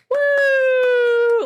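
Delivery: long, high, loud "woo"; cheering whoop. Acted. Townspeople celebrating the heroes, cheering whoop. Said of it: "all of the townspeople are just like".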